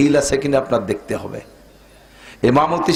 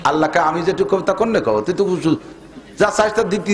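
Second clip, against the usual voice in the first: ordinary delivery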